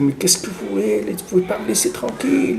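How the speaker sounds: high, hushed imitation of an angry shout; irritated mock yell. Man woken by a knock protesting, irritated mock yell. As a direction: protest shrilly under your breath, as if yelling. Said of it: "il s'est réveillé énervé, en train de crier".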